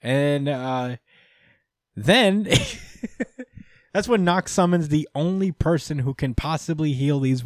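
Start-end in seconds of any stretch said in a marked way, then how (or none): 2.5-3.6 a very high squeaky laugh; real amused squeal; high giggle. Real, not acted. Laughing at summoning twist.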